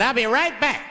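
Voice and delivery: put-on chauffeur voice; limo driver. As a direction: toss it off high as the driver. Quoting the departing driver.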